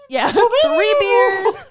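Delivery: ordinary talk, nothing marked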